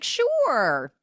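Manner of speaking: high, loud strained yes; mock forced agreement. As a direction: agree too brightly. Retold woman faking agreement.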